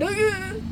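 high-pitched drawn-out mock whine; imitated pained noise. Podcaster showing her back without the backpack, mock whine.

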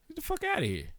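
high incredulous outburst. Acted. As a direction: scoff it out in a high voice. Outraged dismissal.

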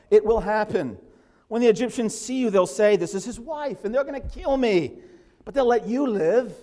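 loud, high, urgent pleading as frightened Abram; alarmed husband voicing his fear; fearful plea. Abram's fearful complaint to his wife.

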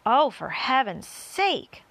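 slow, loud, drawn-out exasperation; exasperated earthworm. A narrator's reading. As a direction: groan the words at a nuisance. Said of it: "said the earthworm".

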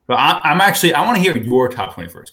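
high, loud playful pushing; excited teasing. Real, not acted. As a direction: pitch jumps up, needling him. Teasing co-host, demanding his picks.

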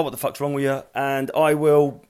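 ordinary talk, nothing marked

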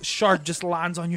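normal talking voice

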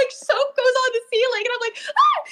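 high, cried-out retelling of a scare; retold fright. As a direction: relive the jump scare with a shriek. Retelling being scared by boyfriend.

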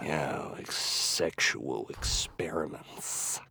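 slow conspiratorial whisper. Pretend. Friend confirming the rumor in a slow conspiratorial whisper. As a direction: hush it like a secret rumor.

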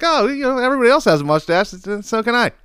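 high, fast, mock-girly reasoning; mock teen girl. Host mocking the commercial girl, high and quick. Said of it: "she's like".

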